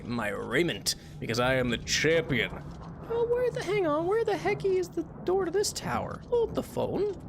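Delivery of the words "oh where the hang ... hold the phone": way up high, squeaky and cartoonish; squeaky silly voice. Streamer lost in the tower, squeaky cartoon voice. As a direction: play a confused little cartoon character.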